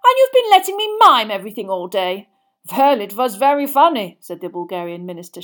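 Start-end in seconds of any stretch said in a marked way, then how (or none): 0.0-2.3 loud, high indignant complaint; outraged. A narrator's reading. Minister scolds his guest for a day of miming, outraged. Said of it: "sounding outrage". 2.7-4.2 loud foreign dignitary voice; Bulgarian minister. Acted. Bulgarian minister teases his outraged host, unapologetic.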